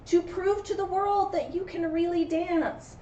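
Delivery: lively storybook read, loud cries of no; dramatic read-aloud to a class; story reading. A narrator's reading. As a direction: read the rhyme with big swings for kids.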